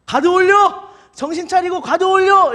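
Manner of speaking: loud, high-pitched ringside yell; coach's shout. Acted. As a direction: yell urgently at your fighter from the corner. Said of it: "코치들이 외칩니다". (코치가 선수에게 크고 높게 외치듯)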